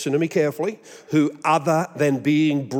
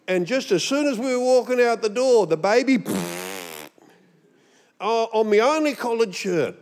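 excuse-making friend; 6 semitones higher than usual. Retold or imitated. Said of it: "things I've heard my friends say".

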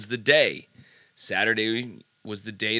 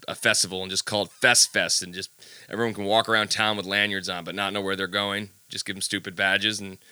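normal talking voice

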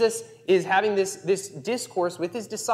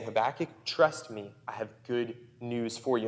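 tender God voice; 5 semitones lower, 6 dB quieter than usual. Acted. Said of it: "he's saying".

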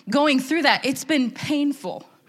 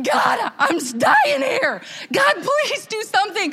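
desperate plea; 9 semitones higher, slower than usual. Acted. Preacher acting out her fasting prayer, desperate plea. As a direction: beg dramatically, slowly, at a high pitch.

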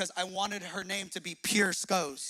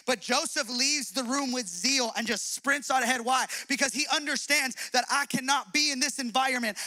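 raised, higher preaching voice, driving hard; full-force preaching; 6 semitones higher, 4 dB louder than usual. Real, not acted. Preacher urging the church to flee like Joseph, full-force preaching. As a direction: preach it with rising conviction and force.

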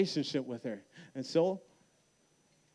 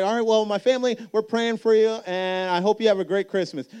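much higher, louder bright caller voice; cheerful caller; 8 semitones higher, 8 dB louder than usual. Retold or imitated. The caller phoning on Christmas Day, high and louder. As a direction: play the friend calling, high and eager.

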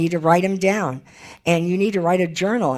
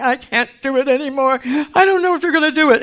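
high, wailing plea of despair; hammed-up tearful outburst; mock sobbing; 10 semitones higher, 6 dB louder, faster than usual. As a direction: wail it fast and high, like someone at breaking point. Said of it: "you're sobbing and crying and saying".

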